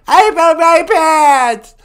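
put-on hard-of-hearing old woman; old lady voice. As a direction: croak like a deaf granny. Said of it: "She's really old and nasty".

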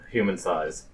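ordinary talk

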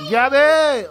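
loud call across the house. Real, not acted. Grandpa calling granddaughter Gabby from another room, loud call. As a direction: call her name out loud to the next room.

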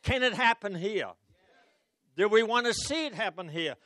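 ordinary talk, nothing marked

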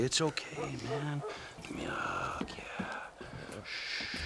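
tender whisper. Acted.

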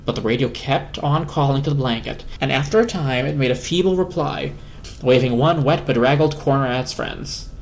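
plain reading voice

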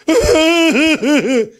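loud, high blubbering; mock sobbing wail. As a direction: burst into exaggerated high sobs. Given money, man wails again. (פרץ בכי מעושה)

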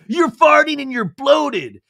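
high, loud, stretched-out retort; mock outburst. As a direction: imitate her crying out, slow and high. Acts out her blunt retort.

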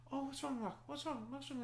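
put-on very high, soft mother cooing to baby; high-pitched baby-talk voice; fussing mother. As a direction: coo high and gently to a crying infant. Mother soothing her crying baby.

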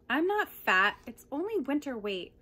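loud, hurt insistence on NOT; indignant denial. Acted. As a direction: protest it hard, stung by the insult. Cat insisting it is not fat.

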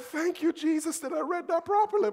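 high, loud relieved thanks; relieved praise. Retold or imitated. Husband relieved he misread his wife's phone, praising. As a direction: thank Jesus in sheer relief. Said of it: "And I went".

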